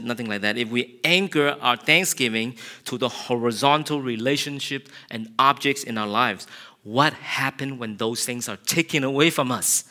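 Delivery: normal talking voice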